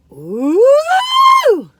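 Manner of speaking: high loud surprised ooh; delighted ooh. Real, not acted. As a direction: squeal of delight at the filling. Breaking donut open.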